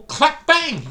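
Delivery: high, loud clap, bang; mock explosion. Speaker acting out a missile strike, mock explosion. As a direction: burst out the impact noise. Imitating a hypersonic weapon hit.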